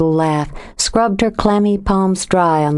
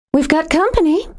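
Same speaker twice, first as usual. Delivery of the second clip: much higher, forced bright alert; too high and bright; 11 semitones higher than usual. A narrator's reading. Jo warns Kirby of a stranger, nervously high and bright. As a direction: chirp it out, tense and too bright. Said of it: "her voice was too high and too bright".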